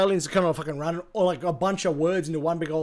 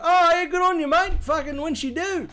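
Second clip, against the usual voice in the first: high, hammed-up Australian mate reacting; imitated Aussie bloke; 12 semitones higher, 4 dB louder than usual. Streamer imitates Australians understanding the slang, high mock-matey voice. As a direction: play a delighted Aussie, pitched way up. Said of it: "They'd be like".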